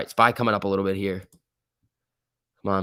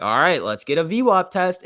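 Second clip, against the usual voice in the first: excited; 7 semitones higher, 5 dB louder than usual. Retold or imitated. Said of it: "We're like".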